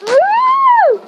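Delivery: very high, loud trumpeting; elephant trumpet. Shown as a demonstration. Listing animal sounds, elephant trumpet.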